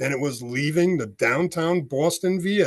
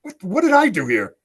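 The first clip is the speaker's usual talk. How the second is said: baffled exasperation; 8 semitones higher, 4 dB louder than usual. Retold or imitated. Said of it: "I'm like".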